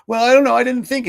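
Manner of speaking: put-on high, loud childish reply; boy defending himself to his father; child answering dad. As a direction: whine it out as a kid caught out.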